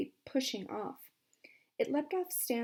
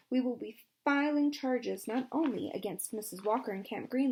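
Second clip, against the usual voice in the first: angry threat; 4 semitones higher, 5 dB louder than usual. Acted. Lawyer threatens the warden.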